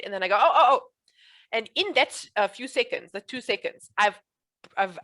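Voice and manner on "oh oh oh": mock sudden recall, a touch louder; pretend remembering. Shown as a demonstration. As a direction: act like it just came back to you. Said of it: "I pretend like I forget".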